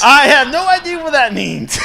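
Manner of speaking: very loud, high-pitched cry of confusion; comic baffled shout. Acted. Fan reacting to Billy's big words, baffled comic shout. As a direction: blurt it out at full volume, playfully exasperated.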